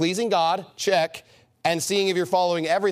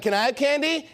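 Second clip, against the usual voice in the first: put-on higher-pitched kid's request; kid asking; 6 semitones higher than usual. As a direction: lightly imitate a child asking hopefully. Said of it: "they kinda look at you like".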